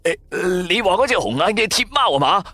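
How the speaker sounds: high hurried friend voice; nervous roommate, pitched high; flustered friend. Acted. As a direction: scramble to explain where the cat is. Friend now awake, scared.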